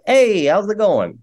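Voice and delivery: mock little LA kid saying "Hey, how's it going?" with no "eh"; California kid accent. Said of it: "And they're like".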